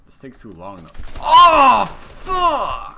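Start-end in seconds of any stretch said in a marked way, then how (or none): 1.2-3.0 hyped gamer bro. Real, not acted. Getting shot in game.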